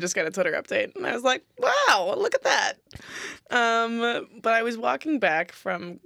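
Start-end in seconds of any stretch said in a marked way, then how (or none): 1.6-2.7 high-pitched exaggerated wow; mock amazement. Co-host reacting to Twitter update, mock amazement. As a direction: marvel at it in a much higher voice.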